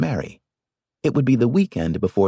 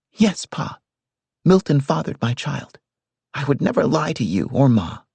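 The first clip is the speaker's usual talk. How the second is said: put-on high, earnest young woman; earnest daughter; 6 semitones higher than usual. A narrator's reading. Daughter swears to her father, high and earnest.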